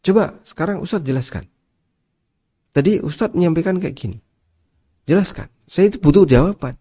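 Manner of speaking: mildly acted pushy questioner; mock demanding. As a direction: press for an answer, half-hearted snapping. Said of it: "jangan bertanya sambil bentak".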